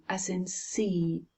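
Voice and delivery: ordinary delivery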